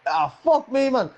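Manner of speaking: hammed-up oh no; mock dismay. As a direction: ham up the despair. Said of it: "And I was like".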